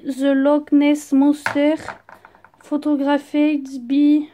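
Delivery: English read slowly in a thick French accent; slow, poor English pronunciation, French-accented; bad English accent. Real, not acted. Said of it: "j'ai un accent anglais pourri".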